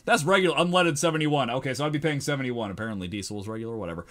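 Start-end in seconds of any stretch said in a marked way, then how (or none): none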